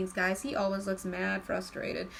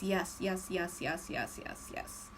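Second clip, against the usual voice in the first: repeated yes, fading from soft to a whisper; 3 semitones lower, 6 dB quieter, slower than usual. Acted. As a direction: let each yes drop softer until only breath is left.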